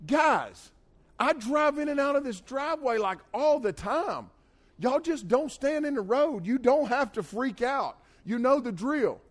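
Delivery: high-pitched, drawn-out telling-off; exasperated scolding. Acted. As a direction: plead with the dumb chickens in exasperation. Exasperated lecture to his chickens.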